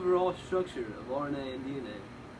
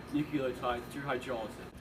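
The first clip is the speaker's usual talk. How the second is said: ordinary talk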